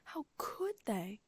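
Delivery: slow, broken whisper; choked whisper. A narrator's reading. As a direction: barely get the words out. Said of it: "Hermione choked out".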